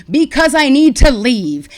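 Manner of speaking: loud, frustrated retort at the doctor; angry outburst. Retold or imitated. Patient yelling at her doctor to release her, loudly. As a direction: yell that you need to leave.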